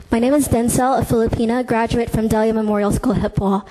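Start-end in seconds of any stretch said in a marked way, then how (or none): none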